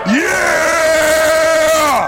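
long high cheer to the crowd; cheering shout. Real, not acted. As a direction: whoop it out long.

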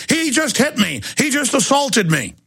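high whiny mock complaint; lightly voiced whining thief; mock fake victim.

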